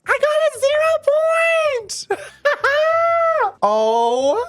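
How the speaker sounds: excited scream. Real, not acted. Screaming with joy at pulling the sprite she hoped for. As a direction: shriek with joy at the lucky pull.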